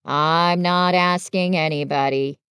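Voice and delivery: low, grumbling donkey voice; Eeyore, grumpy. Acted. Reader voices gloomy Eeyore.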